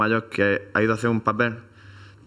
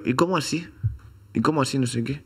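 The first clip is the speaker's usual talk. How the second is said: mock Mexican accent; 5 semitones higher than usual. Told there's no guacamole, he answers in a high mock Mexican accent. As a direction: parrot the local accent, pitched up. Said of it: "me sale hablar con ese acento".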